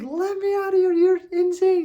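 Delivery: hammed-up cry to be let out; mock scream. Wife locked in the room, mock scream. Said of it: "she starts screaming".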